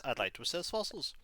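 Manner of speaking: ordinary talk, nothing marked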